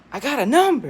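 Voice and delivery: high, loud burst of triumph; excited exclamation. Acted. Boy celebrating a girl's phone number, excited yell. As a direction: crow it out, thrilled.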